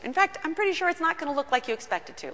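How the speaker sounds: quick, tumbling assurance; rushed aside. Shown as a demonstration. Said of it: "Let me just tell you".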